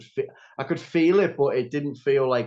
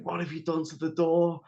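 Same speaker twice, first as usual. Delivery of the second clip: retold scream; 5 semitones higher than usual. Son's mother demanding answers about the door, mildly mimicked scream. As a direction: imitate an outraged demand in a raised pitch. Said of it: "Like, she screamed".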